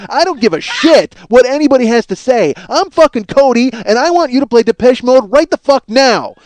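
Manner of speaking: loud, high ranting tantrum; hammed-up yelling. Caller berating the DJ, mock shouting. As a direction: scream your entitlement at him. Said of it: "Cody's like".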